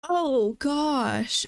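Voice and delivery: ordinary talk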